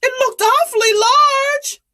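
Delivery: put-on high, nervous boy voice; wondering boy. Shepherd boy wondering at the shape, high voice. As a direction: wonder aloud like a startled kid. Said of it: "the shepherd boy wondered".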